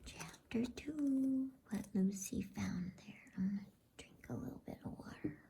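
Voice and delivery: ordinary delivery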